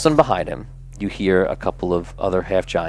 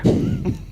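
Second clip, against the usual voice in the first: high mocking laugh; 3 dB quieter than usual.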